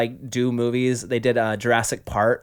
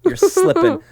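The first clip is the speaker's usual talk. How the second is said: teasing; 4 dB louder than usual. Acted. Teasing spouse.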